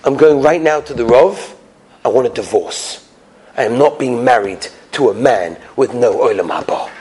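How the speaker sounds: low, measured and dead serious; acted cold anger, low-pitched; stern ultimatum. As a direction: lay down the law to a husband. Wife coldly demands a divorce.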